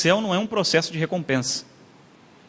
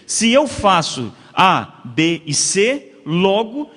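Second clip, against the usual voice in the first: spelled out slowly, step by step; each letter given its own weight; very slow enumeration; 4 dB louder, much slower than usual. Shown as a demonstration. Spelling out cause-and-effect steps. (devagar, letra por letra)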